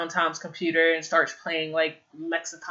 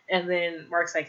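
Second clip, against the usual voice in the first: ordinary talk, nothing marked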